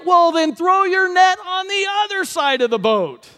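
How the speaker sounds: high, loud, drawn-out holler; distant shout. Acted. Stranger on shore instructs fishermen, distant shout. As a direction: stretch it out to carry across water.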